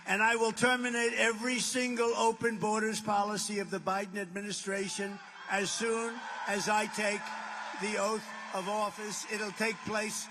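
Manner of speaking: loud, driving rally shout at full force. Real, not acted. To the cheering crowd, promising to shut the border, a loud rally shout. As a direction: hammer each promise home to the crowd.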